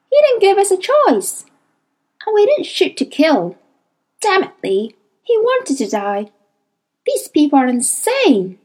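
steady Lee against his guilt; blunt daemon Hester. Acted. Hester voiced, consoling and angry.

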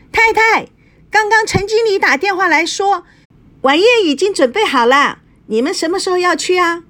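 a maid bursting in with high-pitched news; shrill maid's voice. A narrator's reading. Maid announcing dinner to the mistress, high and piercing. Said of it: "扬起她尖尖的嗓门".

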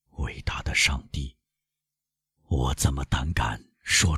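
low, soft, appalled near-whisper; hushed horror. A narrator's reading. Villefort recalls naming the scaffold to his wife, hushed and horrified. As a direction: sink into quiet dread.

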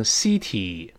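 "city" wrongly said as "see-tee"; mispronounced first vowel. Shown as a demonstration. Teacher warning against a misreading, "city" as see-tee. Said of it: "更不能读成city".

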